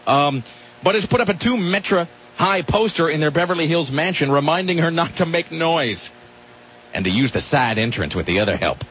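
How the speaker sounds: ordinary talk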